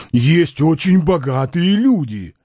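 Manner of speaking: slow, drawn-out insistent exclamation; exclaiming Balaganov. A narrator's reading. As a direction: insist with stretched vowels in a character voice. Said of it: "воскликнул Балаганов". (настойчивое протяжное восклицание)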